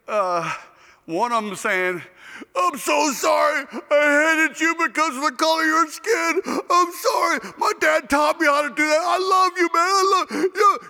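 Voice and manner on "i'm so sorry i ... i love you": high, hammed-up crying confession; mock tearful apology. Player apologising to his teammate for hating him, acted tearful wail. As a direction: wail the apology loudly, imitating a weeping man. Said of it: "Laurel starts to cry".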